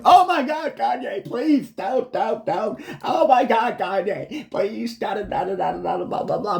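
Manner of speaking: high, breathless fan begging, hammed up; mock pleading fan. Mocking fans who beg [name], high pleading whine. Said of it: "you're looking at him going".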